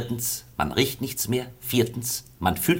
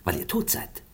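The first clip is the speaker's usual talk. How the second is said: ominous whisper; 5 semitones lower, 6 dB quieter than usual. Acted. Ominous aside to the prince.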